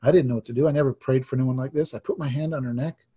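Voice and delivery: ordinary delivery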